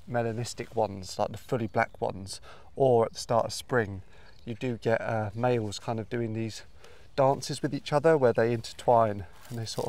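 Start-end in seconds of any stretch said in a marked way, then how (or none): none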